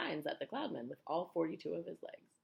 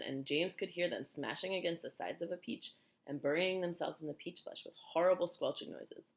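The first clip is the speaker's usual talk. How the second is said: usual speaking voice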